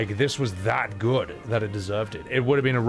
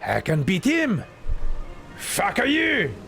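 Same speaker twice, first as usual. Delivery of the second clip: excitable, exaggerated comic character voice, pitch leaping high; hammed-up game character; 5 semitones higher than usual. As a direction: read it as an over-the-top cartoonish game figure.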